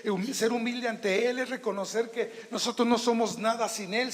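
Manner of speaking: ordinary talk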